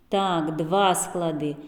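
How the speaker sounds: usual speaking voice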